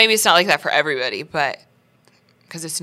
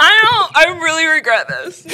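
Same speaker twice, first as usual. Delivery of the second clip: high pained laugh; laugh-crying regret; 8 semitones higher, 3 dB louder than usual. Real, not acted. Suffering, laughing.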